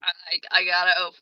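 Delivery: ordinary talk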